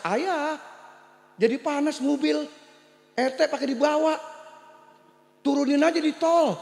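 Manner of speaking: put-on high whiny child's complaint; child protesting. Child in back seat complaining to father about smoke, high and whiny. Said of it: "Anak protes".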